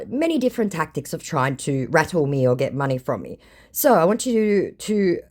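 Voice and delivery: ordinary talk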